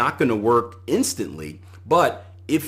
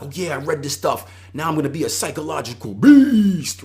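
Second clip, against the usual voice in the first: louder, cocky boast of a pumped-up reader; mock hype; 4 semitones higher, 5 dB louder than usual. Mocking traders who read one book, in a cocky hyped voice. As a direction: ham up the overconfident trader.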